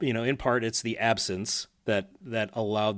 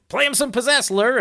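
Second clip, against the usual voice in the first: high loud call to the guitarist; mock stage call; quoting Les; 12 semitones higher, 7 dB louder than usual. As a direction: shout it up to Lur like the frontman.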